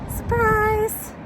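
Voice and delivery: high loud surprise call; excited. Real, not acted. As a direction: announce it with a burst. Surprise reveal to viewers.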